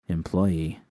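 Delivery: taste the word Employee. like a new title; savoring the word. A narrator's reading. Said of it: "I liked the sound of that".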